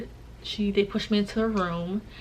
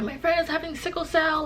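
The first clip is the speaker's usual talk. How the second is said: high-pitched mock urgency; high, alarmed retelling; imitated freaking out; 9 semitones higher than usual. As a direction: imitate a panicked friend announcing it, pitched up. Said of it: "My friends are freaking out there".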